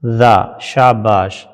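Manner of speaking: ذا stretched out slowly; slow sound demo. Shown as a demonstration. Teacher sounding ذا for the class. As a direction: sound out ذا long and clear. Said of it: "ذال زبر".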